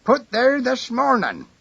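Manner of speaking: put-on high, slow old man voice; a tiny old man, high and slow; small elderly voice. A narrator's reading. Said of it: "A small, elderly voice".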